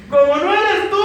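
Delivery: high, loud comic protest; playful wailing comeback; mock complaint. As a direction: throw the retort up at God, exaggerated. Repeats comic retort to God.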